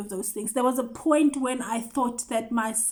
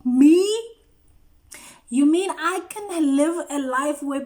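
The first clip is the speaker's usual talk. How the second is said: loud amazed cry; 3 semitones higher, 5 dB louder than usual. Real, not acted. Joyful disbelief at being loved, loud astonished cry.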